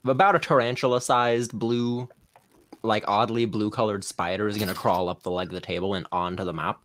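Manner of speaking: ordinary talk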